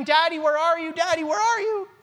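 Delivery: mock a small child whining for dad; crying child. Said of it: "as they're crying".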